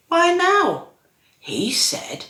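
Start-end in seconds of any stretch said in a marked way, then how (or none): 0.1-0.9 high, loud, wondering question; puzzled question. Acted. Narrator asking the snowman about his visit, puzzled and high. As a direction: pitch it right up, wanting to know.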